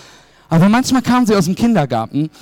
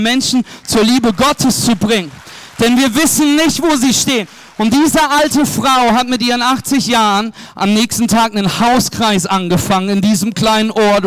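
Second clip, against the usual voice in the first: raised, driving preacher's voice, pushing each phrase; fervent preaching; 4 semitones higher than usual. Acted. Pastor urging the church to share God's love, fervent preaching.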